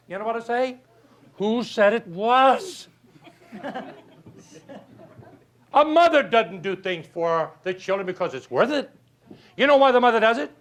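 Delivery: ordinary delivery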